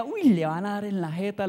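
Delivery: lightly imitated schoolboy goading; taunting triplet. Triplet warning the Peruvian of a beating, imitated taunt. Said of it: "el trillizo le decía".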